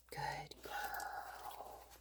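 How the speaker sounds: barely voiced reward to the dog; hushed praise; whispered praise. Real, not acted. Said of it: "whispered praise to dog".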